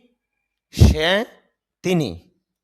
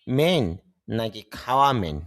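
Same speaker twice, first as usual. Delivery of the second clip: ordinary talk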